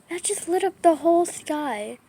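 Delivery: thrilled, slightly calmer exclamation; excited amazement. Real, not acted. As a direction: marvel aloud at the flash. Repeats amazement at the lightning.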